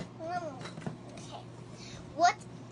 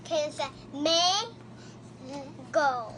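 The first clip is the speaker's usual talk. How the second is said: slow prompt; much slower than usual. Shown as a demonstration. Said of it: "teacher prompting slowly".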